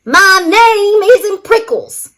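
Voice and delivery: high, loud grumpy retort; yelling back. A narrator's reading. Hank yelling back at Rosie over his nickname. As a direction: snap the correction back. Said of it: "Hank yelled back".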